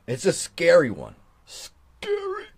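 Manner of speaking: usual speaking voice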